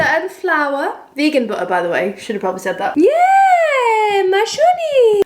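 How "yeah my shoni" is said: loud, very high Simlish cheer; Sim language. Acted. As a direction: cheer in squeaky gibberish like a happy Sim. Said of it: "where I talk in Sim language".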